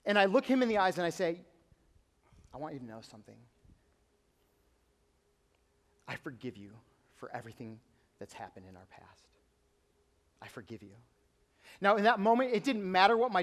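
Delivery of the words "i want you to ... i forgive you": much softer, hushed and sincere; quiet, earnest forgiving voice; tender forgiveness. Retold or imitated. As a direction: say it gently, from the heart. Forgiving his dad face to face.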